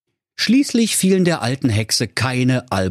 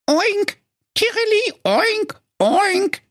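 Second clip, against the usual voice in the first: very high squealing oinks; pig-bird calling; slower than usual. Acted. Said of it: "rief in ihrer Not". (sehr hohes, quiekendes Oinken in Not)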